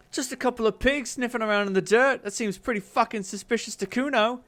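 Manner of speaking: put-on high sneering kid; a smug brat calling them pigs; bratty kid Kuno. Kid sneers at the cop, high and smug.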